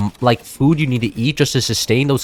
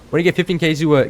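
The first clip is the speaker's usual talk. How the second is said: normal talking voice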